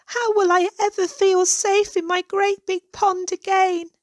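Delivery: high, tearful plea; sobbing. A narrator's reading. Frightened goose wondering if she'll be safe, sobbing. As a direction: cry it out, voice lifted high with tears. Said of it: "she sobbed".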